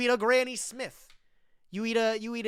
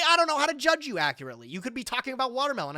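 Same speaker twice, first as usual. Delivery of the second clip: ordinary talk, nothing marked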